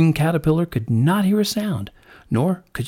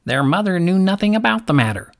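lightly voiced lark, pitched up; a hint of a know-it-all lark; dismissive lark; 6 semitones higher than usual. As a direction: answer back in a raised bird voice.

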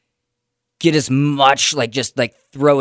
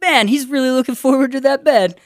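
excited; 13 semitones higher, 3 dB louder than usual. Pretend. Mocking excited bedtime.

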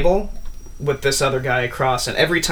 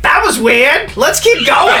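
much higher and louder, bright laughing exclamation; excited cheer; 14 semitones higher, 9 dB louder than usual. Acted. Spawn-san to the party, high loud and laughing.

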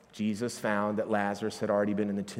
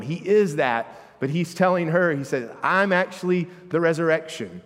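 loud, high preaching climax; full-force preaching; 6 semitones higher, 7 dB louder than usual. Acted. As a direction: build the sermon to a ringing peak.

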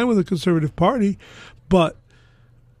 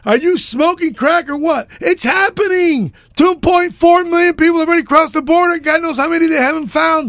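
angry; 6 semitones higher, 3 dB louder than usual. Real, not acted. Heated quarrel, exasperated yelling.

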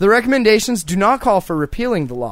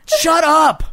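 high mock shout at hecklers; 10 semitones higher, 5 dB louder than usual. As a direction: yell it up at the crowd.

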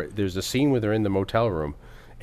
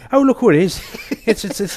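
loud, high mock Irish townsfolk; fake Irish accent; 12 semitones higher, 8 dB louder than usual. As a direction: ham up an Irish local greeting. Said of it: "everyone in the town with fake Irish accents".

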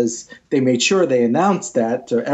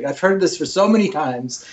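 usual speaking voice

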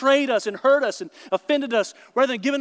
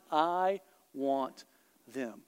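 God speaking softly; 7 semitones lower, 7 dB quieter, much slower than usual. Acted. Preacher voicing God softly.

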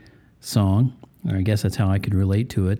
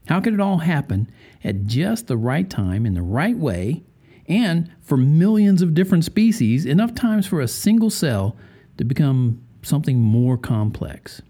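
normal talking voice

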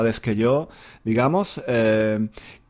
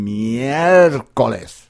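drawn-out miércoles; much slower than usual. Shown as a demonstration. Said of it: "en lugar de mierda".

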